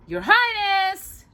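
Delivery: a hammed-up, high, drawn-out blurt. A narrator's reading. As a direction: burst in to interrupt the king. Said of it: "Jafar blurted".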